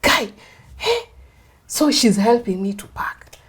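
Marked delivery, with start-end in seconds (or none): 0.0-1.1 a friend aghast at your man; outraged friend. Retold or imitated. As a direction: friend shocked at the husband, high and loud.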